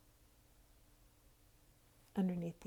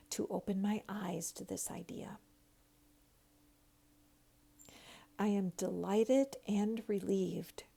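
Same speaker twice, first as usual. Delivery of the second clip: guided affirmation. Acted. Leads affirmations to repeat.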